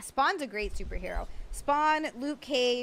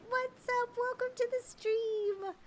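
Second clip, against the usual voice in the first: sing-song greeting; 13 semitones higher than usual. Real, not acted.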